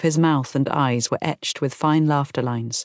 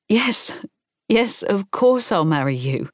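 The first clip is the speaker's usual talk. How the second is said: higher-pitched, delighted yes; happy acceptance; 6 semitones higher than usual. A narrator's reading. Woman accepts her boyfriend's proposal, delighted. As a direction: say yes eagerly, voice lifting.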